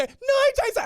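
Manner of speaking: put-on very high, loud kid shout; fired-up little girl yelling; child meaning it. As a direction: squeal the name out boldly. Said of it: "Now say it like you mean it".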